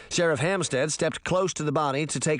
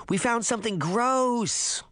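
put-on high, slow little girl's wail; a small girl upset, reaching for granny; little girl crying out; 10 semitones higher than usual. A narrator's reading. Little girl to her grandmother, high upset cry. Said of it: "the little girl cried".

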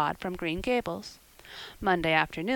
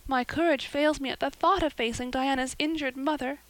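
sighing; 7 semitones higher than usual. Acted. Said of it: "sighed Anne".

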